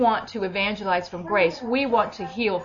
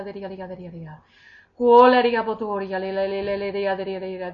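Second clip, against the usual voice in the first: steady rhythmic tongues; praying in tongues. Real, not acted. Praying over Lizzie, steady rhythmic tongues. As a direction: let the syllables flow in prayer. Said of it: "I'll start in tongues".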